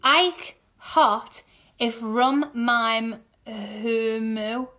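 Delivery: "Ike hot if rum mime humu" word by word; very slow, separated words; slow sounding-out. Real, not acted. Sounding puzzle out slowly.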